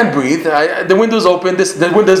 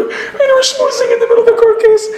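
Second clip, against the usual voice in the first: laughing amazement; 3 dB louder than usual. Real, not acted. Amused recap of the court chat.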